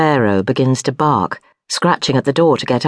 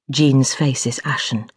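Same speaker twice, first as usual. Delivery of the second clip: plain reading voice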